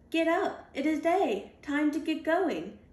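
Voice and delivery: a reader cheering the dogs awake and off; loud wake-up call. Acted. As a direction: rouse the dogs and send them off. Waking dogs, calling them to go.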